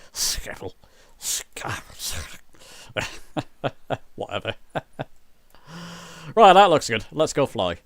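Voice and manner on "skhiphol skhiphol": Schiphol half-whispered with an SK opening; low, quiet attempts at Dutch "SKHIP-ol" for Schiphol; trying Dutch Schiphol. Shown as a demonstration. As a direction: try out Schiphol under your breath, starting sk. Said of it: "the Dutch way of saying it".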